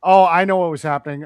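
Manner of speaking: ordinary talk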